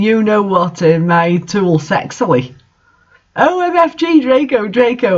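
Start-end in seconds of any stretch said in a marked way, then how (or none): 3.4-5.2 high, hammed-up ecstatic squeal; overacted high-pitched cry of the name; mock scream. A narrator's reading. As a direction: shriek the name in mock passion. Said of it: "I screamed".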